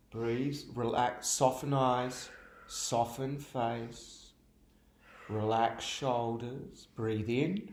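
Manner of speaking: gentle yoga teacher settling the class; soothing yoga cue. Acted. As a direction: ease them down into the stretch slowly. Calming cues during the stretch.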